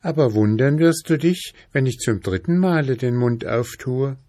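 put-on high, ominous girl's voice; menacing princess voice. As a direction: warn him high and eerie. Princess threatens father.

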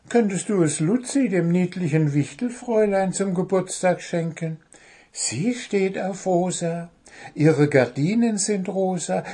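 high, chirpy bird voice; eager high-voiced storybook bird; persuading little bird. A narrator's reading. Bird persuades gnome with gift idea.

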